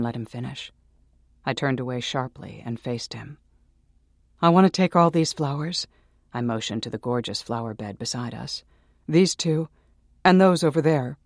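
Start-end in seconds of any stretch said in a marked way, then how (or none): 4.4-11.2 loud, high demand; loud demand for the flowers; defiant outburst. Acted. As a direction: shout it to be overheard. She defies him, raising voice.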